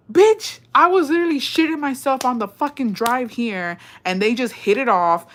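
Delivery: loud, high exclamation; worked-up squeal of disbelief; exasperated disbelief. Real, not acted. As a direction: burst out high and loud, easing down. Exclaims at having worried for nothing.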